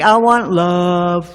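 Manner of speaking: I want love, stretched slowly; slow drawn-out plea. Acted. To the audience about drinking, a slow drawn-out plea. Comic climax of drinking list.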